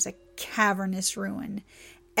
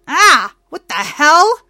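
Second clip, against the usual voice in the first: loud, high-pitched yelp of shock; pained cry; 8 semitones higher, 17 dB louder than usual. A narrator's reading. Trixie's wrist burns with cold pain, crying out. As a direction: cry out from sudden searing pain. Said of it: "She cried out".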